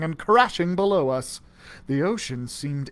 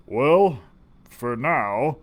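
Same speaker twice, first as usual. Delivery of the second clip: deep mutter; 5 semitones lower, much slower than usual. A narrator's reading. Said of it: "a deep voice muttered".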